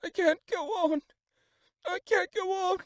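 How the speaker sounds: high, loud sobbing plea; weeping cry. A narrator's reading. Little girl collapses under hedge, weeping high cry. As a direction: break down crying, exhausted.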